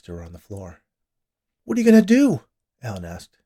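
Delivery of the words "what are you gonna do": high, loud and frightened; panicky, raised voice; alarmed question. A narrator's reading. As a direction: demand it in rising panic. Frightened man questions armed nurse.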